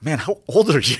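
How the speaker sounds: high, loud mock amazement; surprised disbelief. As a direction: act out being stunned by his age. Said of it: "I'm like".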